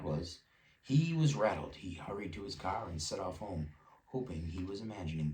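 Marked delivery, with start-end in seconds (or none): none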